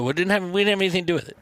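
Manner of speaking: fast, defensive rush. Retold or imitated. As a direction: blurt it out quickly. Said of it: "I go".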